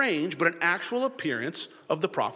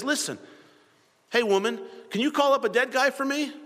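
higher-pitched, pushy casual ask; brash request; 9 semitones higher than usual. Acted. Saul asking the witch to raise the dead, brash and high. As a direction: act out a cheeky, forward request.

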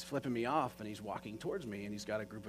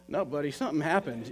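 louder, higher, pressing a friend for the truth; retold insistent pushback; 4 semitones higher, 8 dB louder than usual. Storyteller challenging Tiger who said it was nothing, insistent. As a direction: re-enact refusing to be brushed off.

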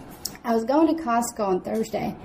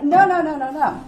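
sharp raised protest, higher and louder; urgent insistent no; 5 semitones higher, 6 dB louder than usual. Retold or imitated. Telling staff not to fetch a manager, urgent loud no. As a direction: cut them off, urgent and firm. Urgent refusal in store emergency.

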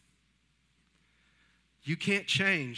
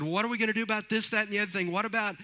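fast, higher, mildly anxious questions; worried disciples; 8 semitones higher, 4 dB louder, faster than usual. Retold or imitated. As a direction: rattle off the disciples' fretting. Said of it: "The disciples said".

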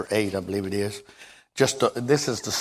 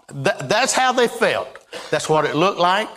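fervent; 7 semitones higher than usual. Real, not acted. Preacher rising in fervor.

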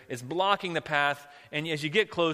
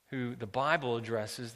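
normal talking voice

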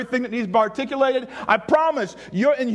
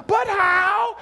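high, drawn-out protest, rising in disbelief; protesting doubter; 8 semitones higher than usual. Acted. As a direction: act out a listener balking at God. Imagined listener protesting to God.

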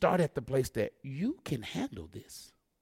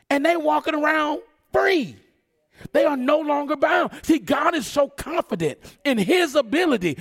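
impassioned, raised sermon delivery; full-force preaching; 8 semitones higher, 5 dB louder than usual. Real, not acted. Preacher urging the congregation about the fiery furnace, full-force preaching.

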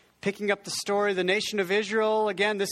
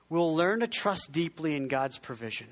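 ordinary talk

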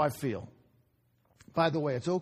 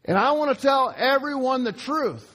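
raised, high-pitched impassioned outburst; mock screaming; 8 semitones higher, 5 dB louder than usual. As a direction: cry it out as if up on a chair, short of a full scream. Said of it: "and screaming".

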